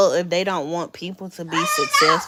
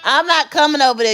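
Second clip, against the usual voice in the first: louder call across the room; firm call; 6 semitones higher, 7 dB louder than usual. Real, not acted. Mom refusing to come to her son. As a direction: call firmly, not going to him. Calling to child.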